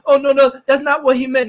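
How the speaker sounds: loud, high mock protest; hammed-up, insistent objection; mocking denial. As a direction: parody someone making excuses. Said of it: "try to say what he meant".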